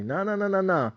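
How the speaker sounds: normal talking voice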